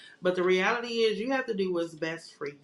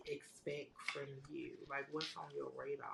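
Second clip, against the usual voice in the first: whisper; 3 semitones lower, 16 dB quieter than usual. Real, not acted. Whispered aside to guest.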